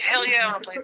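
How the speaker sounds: high, excited mock yes; hammed-up enthusiasm; eager about-face. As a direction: jump at the offer. Acts out his eager about-face.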